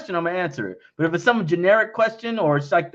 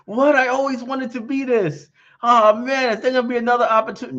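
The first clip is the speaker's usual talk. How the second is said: higher, rushed mock-whiny fan voice; 7 semitones higher than usual. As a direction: play the fan who missed the deal, rushed and high. Mocking a viewer who missed out.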